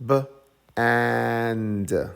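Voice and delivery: b-, and as a separate b, then stretched and; phonics-style b... aaand; sounding out. Shown as a demonstration. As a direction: split b-, and slowly, holding the vowel. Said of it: "Let's read the first syllable".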